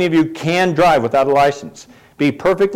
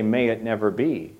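softer, low, settled; quiet firm reply; 6 semitones lower, 9 dB quieter than usual. Shown as a demonstration. Preacher coaching the congregation's answer, quiet and low. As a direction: say it quietly, as a calm rebuke. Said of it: "then you say".

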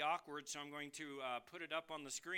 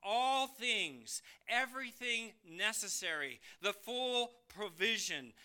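"All things" punched loud, then each phrase driven home; emphatic stress; 5 semitones higher, 5 dB louder than usual. Shown as a demonstration. Preacher expanding Peter's main truth, emphatic stress on "All things". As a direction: hit "All things" hard and hold it. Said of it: "he's stressing this point".

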